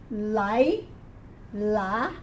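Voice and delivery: "Lai", pause, "La", each syllable on its own. Shown as a demonstration. Teacher going through the vowels for a student, "Lai, La" syllable by syllable. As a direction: softly sound out "Lai, La" in two separate pieces.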